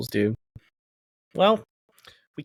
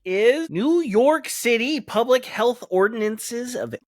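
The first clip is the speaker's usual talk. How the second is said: announcer intro; 8 semitones higher, much slower than usual. Acted. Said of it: "announcing topic".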